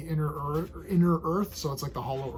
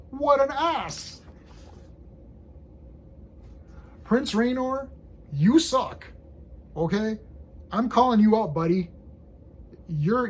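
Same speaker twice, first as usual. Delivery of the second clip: indignant rant; 5 semitones higher than usual. Real, not acted. Fan yells at the comic's prince, mock-furious rant. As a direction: tell off the prince like he's in the room.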